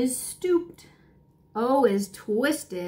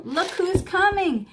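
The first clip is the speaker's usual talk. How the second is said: excited; 7 semitones higher than usual. A narrator's reading. Reading to kids, building excitement.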